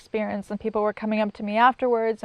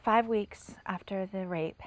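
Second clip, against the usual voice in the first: whispered; 5 dB quieter, slower than usual. Real, not acted. Opening a somber chapter.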